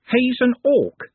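put-on young girl voice. A narrator's reading.

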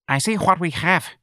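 high, enigmatic barmaid voice; knowing barmaid. Acted. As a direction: answer coyly in a high voice. Barmaid answers Ignatius.

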